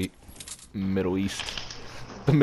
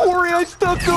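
high, loud triumphant yell; excited shout; 5 dB louder than usual. Real, not acted. Excited after sticking enemy.

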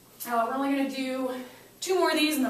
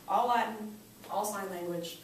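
normal talking voice

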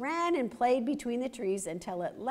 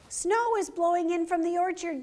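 gasping fox; 8 semitones higher, 4 dB louder than usual. Acted. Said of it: "gasped Fletcher".

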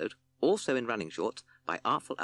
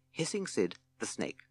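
ordinary delivery

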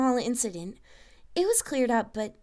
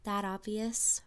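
softer, slow murmur; 2 semitones lower, 5 dB quieter, slower than usual. A narrator's reading. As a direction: Twilight murmurs to Sunset, softer. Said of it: "Twilight murmured".

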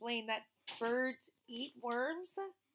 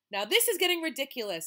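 exasperated mother; 5 semitones higher, 8 dB louder, faster than usual. Acted. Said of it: "said the boy's mother".

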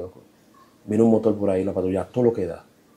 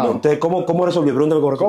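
a louder, higher teasing challenge; excited; 6 semitones higher, 6 dB louder, faster than usual. Real, not acted. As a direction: egg the host on to ask. Teasing the host to ask. (retando al anfitrión a preguntar, entusiasmado)

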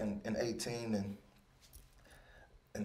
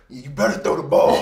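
much louder trash talk; a loud on-field taunt to the opposing quarterback; 3 semitones higher, 12 dB louder than usual. Retold or imitated. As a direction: yell it at the quarterback.

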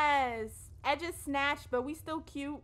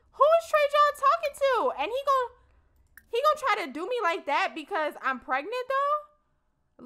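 high loud disbelieving complaint; indignant; 9 semitones higher, 6 dB louder than usual. Real, not acted. Player venting to viewers about Trey John, high and indignant. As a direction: protest like you've been betrayed. Outraged at game character.

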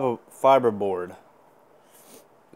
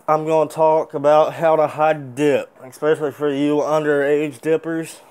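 talking around tobacco pinch; 2 semitones higher, 4 dB louder than usual. Real, not acted. Seen 0: Puts dip in at -9, lower lip slightly full throughout.

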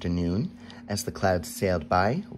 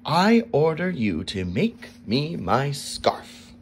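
ordering king; 6 semitones higher, 4 dB louder, slower than usual. A narrator's reading. Angry king commands.

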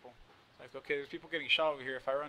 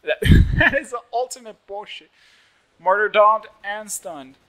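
outraged; 6 semitones higher, 17 dB louder, slower than usual. Real, not acted. Outraged at game death.

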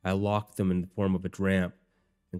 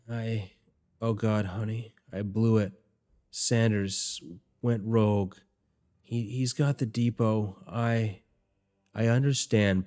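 weak dying voice; 3 semitones higher, slower than usual. Acted. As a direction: force each word out through the pain. Dying man gasping out words.

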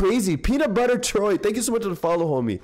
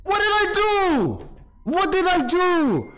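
high, slow, stretched disbelief; baffled outcry; 12 semitones higher, much slower than usual. Real, not acted. Streamer baffled at his game partner's choice, high drawn-out disbelief. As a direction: wail it out in disbelief.

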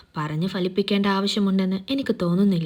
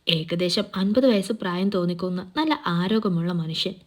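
ordinary delivery

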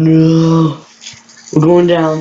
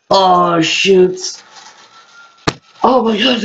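ordinary delivery

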